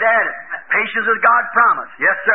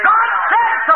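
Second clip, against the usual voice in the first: much higher, louder declaration; emphatic; 11 semitones higher, 4 dB louder, slower than usual. Retold or imitated. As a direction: proclaim it with total certainty. Noah's firm answer to scoffers.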